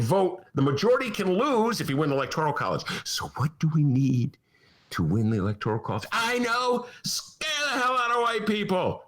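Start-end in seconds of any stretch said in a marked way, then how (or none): 6.1-9.0 higher, smug scheming voice; mock scheming answer. Host voicing Republicans' plan, gleeful and higher. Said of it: "Republicans answering themselves".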